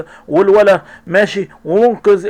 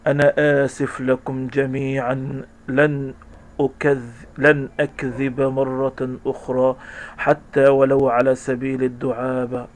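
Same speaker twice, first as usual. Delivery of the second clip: remorseful apology; 5 semitones lower, 7 dB quieter, slower than usual. Acted.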